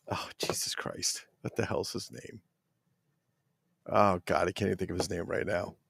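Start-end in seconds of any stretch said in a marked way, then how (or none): 0.1-2.4 exasperated whisper. Real, not acted. Can't recall a name.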